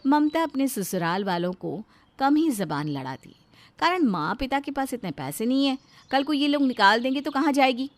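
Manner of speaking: plain reading voice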